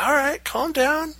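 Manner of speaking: calm wife. Retold or imitated. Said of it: "She's like".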